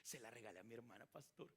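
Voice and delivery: a hint of a proud little boy; child telling the pastor his good deed; excited child. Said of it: "bien emocionado".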